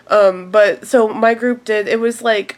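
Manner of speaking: ordinary talk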